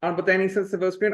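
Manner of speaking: normal talking voice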